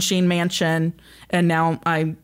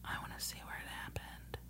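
hushed excited whisper; 26 dB quieter, faster than usual. Real, not acted. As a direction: whisper it like a secret plan. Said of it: "Why are we whispering?".

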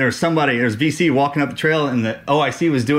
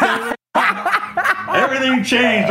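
laughing at the Dixie cup surprise; high laughter; 9 semitones higher than usual. Real, not acted. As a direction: let the laugh carry the words. Laughing at the photo reveal.

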